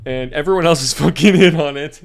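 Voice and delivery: bursting into laughter mid-sentence; cracking up at the plot; laughing. Real, not acted. Laughing while telling the joke.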